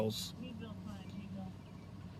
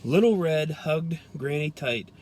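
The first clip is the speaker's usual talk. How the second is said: ordinary delivery, nothing marked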